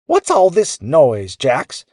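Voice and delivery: high-pitched cross scolding; Missus Tumbleweed growling. A narrator's reading. Grumpy neighbor to sleepless boy, growled complaint. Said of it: "Missus Tumbleweed growled".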